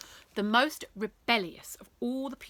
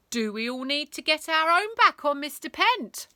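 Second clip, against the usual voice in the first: rousing speech; 7 semitones higher, 8 dB louder than usual. Acted. Rebel rousing crowd.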